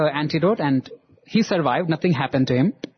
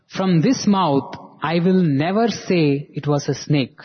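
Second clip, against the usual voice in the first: slow, deliberate words, leaning on never; slow solemn declaration; slower than usual. Retold or imitated. Swami to the boy, slow and solemn about the bite. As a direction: spell out Swami's verdict word by word.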